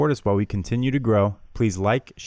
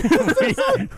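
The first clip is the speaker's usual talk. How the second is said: falsetto whoop. Pretend.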